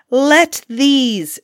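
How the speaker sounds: high, loud storybook cry; yelling curse. A narrator's reading. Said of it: "yelling and cursing".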